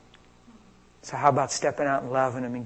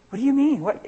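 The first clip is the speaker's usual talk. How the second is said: higher, louder, taken-aback question; startled objection; 10 semitones higher, 6 dB louder than usual. Acted. Stranger asked to hold hands in a store, startled. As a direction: blurt it out, thrown by the request.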